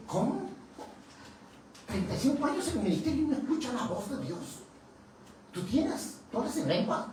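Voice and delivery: high, fast incredulous questions; can't believe what he hears; preacher shocked a minister can't hear God, high disbelief; astonished disbelief. Retold or imitated.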